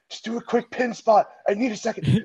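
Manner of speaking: high, strained plea with little wind; acted winded voice; imitating being winded. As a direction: re-enact gasping out words after a hard slam. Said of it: "knocked all of the wind out".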